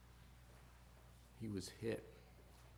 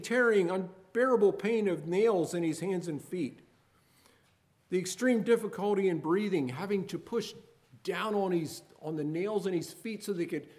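loud, higher pleading why; impassioned preaching; 7 semitones higher, 7 dB louder than usual. Acted. Preacher asking the congregation why Jesus suffered, impassioned. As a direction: cry out each question of pain.

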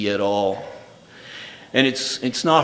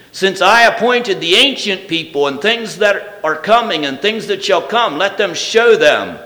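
raised, ringing King James reading with strong falling phrase ends; scripture reading; 5 semitones higher, 5 dB louder than usual. Acted. Preacher reads Isaiah to the congregation, ringing reading voice. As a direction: read it out like God's own declaration.

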